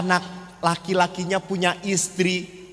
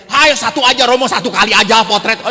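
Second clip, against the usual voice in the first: pushy emak; 3 semitones higher, 8 dB louder, faster than usual. Retold or imitated. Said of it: "Saya tadi mau potret juga".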